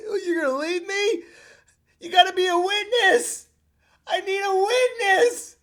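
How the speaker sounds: high, whiny, desperate begging; panicked plea. Retold or imitated. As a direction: wail for a witness, half crying. Dad panics as daughter leaves.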